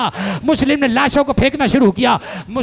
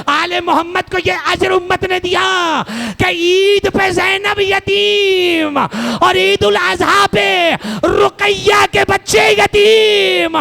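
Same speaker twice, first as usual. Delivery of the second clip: wailing sermon lament; 6 semitones higher, slower than usual. Acted. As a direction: stretch each word out in mourning. Majlis lament at full force.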